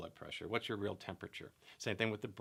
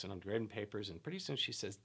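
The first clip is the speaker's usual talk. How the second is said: ordinary talk, nothing marked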